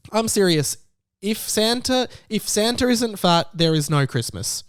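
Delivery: ordinary talk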